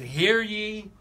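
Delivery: loud ringing town crier call. Acted. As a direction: announce it like an old town crier. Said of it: "from Old England".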